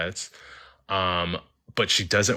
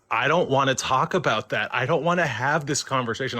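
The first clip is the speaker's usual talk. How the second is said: higher-pitched, mildly distressed refusal; retold emotional upset; 6 semitones higher than usual. Ghost refuses to discuss her death, retold emotional and upset. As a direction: imitate her getting upset and shutting the subject down. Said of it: "then she got really emotional, upset".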